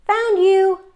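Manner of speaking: high, loud triumphant cry; gleeful shout. Acted. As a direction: burst out with delight at catching her. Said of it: "I laughed".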